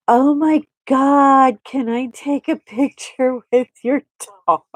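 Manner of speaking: high, stretched-out mock gushing; gushing fan. Star asks a stranger for a photo with her dog, mock gushing. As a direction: imitate someone oozing over a dog. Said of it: "oozing all over Lucy".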